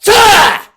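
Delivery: explosive high kiai; full-force, high battle cry; loud martial arts yell. Shown as a demonstration. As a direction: yell it sharply as the sword strikes. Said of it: "with a loud yell".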